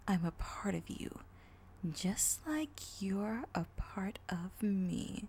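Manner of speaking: creepy villain voice. Acted. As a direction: purr the greeting like a lurking villain. Villain taunts, menacing.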